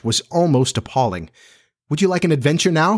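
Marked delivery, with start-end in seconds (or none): 1.9-3.0 quick, higher-pitched, casual question; casual offer. A narrator's reading. Peter offers John an adventure, casually. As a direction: toss the offer out lightly and quickly. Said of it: "he said casually to John".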